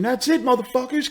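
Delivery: high, snapping mock threat; vengeful kid. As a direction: play the bullied kid losing it. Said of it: "an angry bullied kid coming into school saying".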